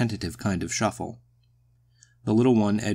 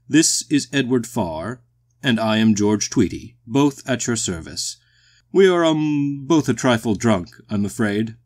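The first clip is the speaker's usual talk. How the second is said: loud, higher, courteous introductions; booming, genial walrus; 3 semitones higher, 7 dB louder than usual. A narrator's reading. The tipsy walrus introduces himself and the carpenter, courteous boom.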